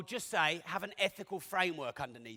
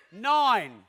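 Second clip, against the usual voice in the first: normal talking voice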